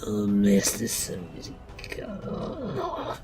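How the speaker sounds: groaning, breath-starved villain hit by a blade; wounded Rat King. Acted. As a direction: gasp it out in pain, slow and whispery in the middle. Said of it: "and the Rat King goes".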